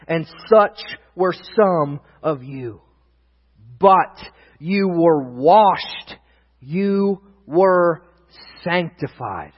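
very slow, weighty verse reading; scripture proclamation. Real, not acted. Preacher reading the next verse, very slow proclamation. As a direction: space out each word for the congregation.

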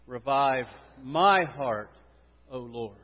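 slow, with "my" swelling strongly before "heart"; stress on my heart. Shown as a demonstration. Preacher leads congregation saying it together, stress on my heart. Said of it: "Here is the emphasis".

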